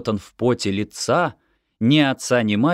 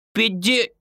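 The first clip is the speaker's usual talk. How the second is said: higher, breaking off at «Пятьде-»; stammering; 13 semitones higher than usual. A narrator's reading. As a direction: choke on the sum and stop mid-word. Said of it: "заикаясь". (заикаясь, обрывая слово)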